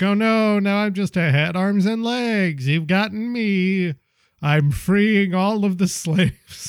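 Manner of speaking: hammed-up orc admitting defeat; mock-dismayed orc. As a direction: draw it out in playful, exaggerated dismay. Acts out the defeated head orc.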